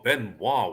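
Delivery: Benoit said the French way as ben-WAH; French pronunciation. Retold or imitated. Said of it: "so they're saying it the French way".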